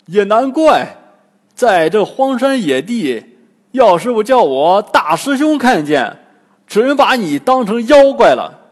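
loud, high, blustering; loud boasting Bajie. A narrator's reading. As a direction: boast loudly to impress the girl. 八戒 boasts to the girl. (八戒大声吹嘘)

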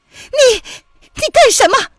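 very high, loud protest; shrill startled shout; startled cry. A narrator's reading. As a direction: cry out in shock at being seized. Startled woman seized, protesting.

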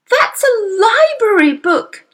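distressed cry. Acted. Young girl crying out as her father tears the book. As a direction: cry out in dismay. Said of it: "Matilda cried".

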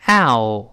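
"ow" gliding from 啊 into 呃; model diphthong. Shown as a demonstration. Teacher leading students through the double vowel, model diphthong. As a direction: draw out "ow" as a clear two-part vowel. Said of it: "先发啊，再发呃。我们读一下".

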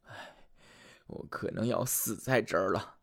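soft, low hopeless sigh; despairing sigh. Acted. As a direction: give up all hope quietly. Soldier despairs at well bottom.

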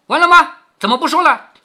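loud, high-pitched prodding; impatient demand. Acted. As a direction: press hard for the rest of it. 凤姐逼问停口的仆人. (不耐烦地高声追问)

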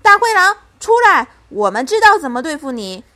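high, loud battle cry; shouted, commanding defiance; loud challenge. A narrator's reading. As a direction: call the enemy out fiercely. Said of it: "大声说".

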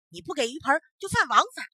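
put-on the magistrate hurriedly threatens the old fisherman, loud. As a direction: bark the law at him.

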